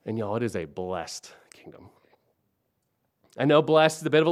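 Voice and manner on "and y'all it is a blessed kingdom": breathy whispered aside; hushed reverent whisper. Real, not acted. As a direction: drop to a whisper on blessed kingdom.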